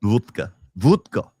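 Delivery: "vodka" as "voot-ka" in Russian accent; heavy Russian vowel on "vodka". Shown as a demonstration. Showing the co-host how, Russian-accented vodka. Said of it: "do it in a Russian accent".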